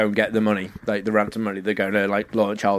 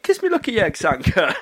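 Sanka catchphrase; 7 semitones higher than usual. Retold or imitated. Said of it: "or Sanka as he first calls her".

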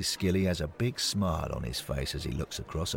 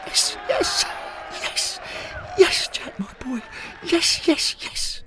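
ecstatic cheering. Acted. Old fan celebrating his descendant's goal, ecstatic shouts. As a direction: scream with joy at the goal. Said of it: "never seen Skilly so excited".

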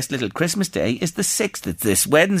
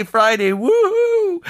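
high, drawn-out cheer of delight; excited woohoo; 14 semitones higher, slower than usual. Real, not acted. Radio host cheering the snow forecast, a high delighted whoop.

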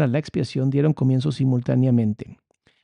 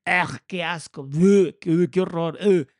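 mock disgust; 3 semitones higher, slower than usual. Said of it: "cuando vemos a la Biblia".

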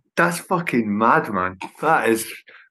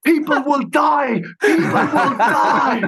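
high, frantic mock shout; stressed-out panic; 12 semitones higher than usual. Venue owner runs up during sound check, frantic shouted imitation. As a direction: shout it high, stretching out the dire warning. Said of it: "he was so fucking stressed out".

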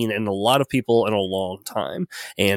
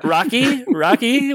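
excited; 12 semitones higher than usual. Real, not acted. Suggesting a comparison excitedly.

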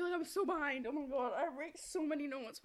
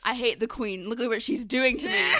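laughing; 6 semitones higher, 17 dB louder than usual. Real, not acted. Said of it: "I'm lisping!".

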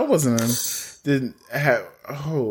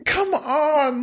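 hammed-up lament; 10 semitones higher, slower than usual. Mock lament for Edith.

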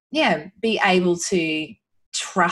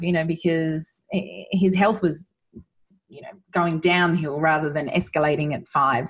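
ordinary talk

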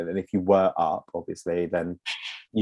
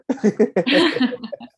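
high laugh; 4 dB louder than usual. Real, not acted. Laugh after thanks.

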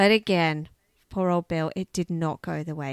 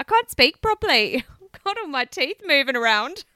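high, loud, giggly words; real laughing; 8 semitones higher, 5 dB louder than usual. Real, not acted. Host blaming teeth for slurred name, laughing. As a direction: laugh through the excuse. Laughing at her own speech.

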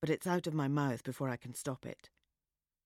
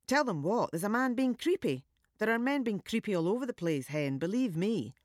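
higher, worldly scoff; scoffing Scottish woman; 6 semitones higher than usual. A narrator's reading. Woman dismissing narrator's worry, scoffing Scottish voice. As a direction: brush it off like she's seen it all.

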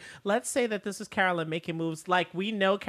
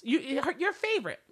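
building up to an outraged cry; rising outrage; 9 semitones higher, 4 dB louder than usual. Real, not acted. Podcast host ranting about who gets sent, rising outrage. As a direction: voice climbing higher and louder, indignant.

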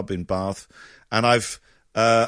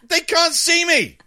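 high, loud incredulous complaint; exasperated protest; 3 dB louder than usual. Retold or imitated. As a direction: protest to the boss in disbelief. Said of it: "And I'm like".

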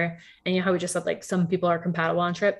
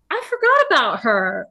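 louder, raised, amazed recall; sudden realization; 6 semitones higher, 8 dB louder than usual. Retold or imitated. Telling her mom, a startled I forgot about her. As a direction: it just dawns on you.

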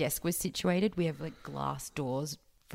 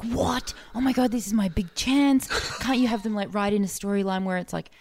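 high, loud, thrilled gushing; excited Steele; 5 semitones higher, 3 dB louder than usual. Retold or imitated. Coworker bursting with excitement over TV chance. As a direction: gush like it's his big break. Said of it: "he was like".

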